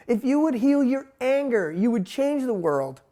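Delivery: ordinary talk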